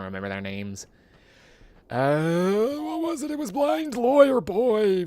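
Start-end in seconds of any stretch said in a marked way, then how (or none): 1.9-5.0 very high, loud strained squeak; high squeaky frustrated voice; squeaky recall. Acted. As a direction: squeak it out like it's on the tip of your tongue.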